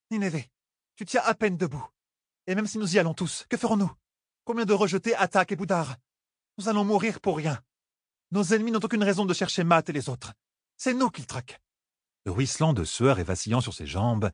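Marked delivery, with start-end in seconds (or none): 0.1-11.6 put-on raised pitch young woman pleading; young queen. Elaine urging Nineveh not to go, raised voice. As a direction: persuade her friend to stay.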